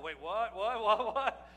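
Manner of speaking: startled double take breaking into a laugh; surprised what. Acted. Man who agreed blindly suddenly realizing, shocked laughing what. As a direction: blurt it out, shocked, then laugh. Shocked reaction in acted joke.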